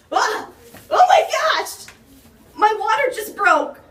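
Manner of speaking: high, loud panicked yells with a laugh breaking through; panicked screaming. Acted. Wife whose water broke screams to Karen in hammed-up panic.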